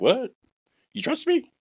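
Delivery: stunned he'd be trusted with it; a high, startled what; disbelief. Retold or imitated. Retold disbelief at being trusted.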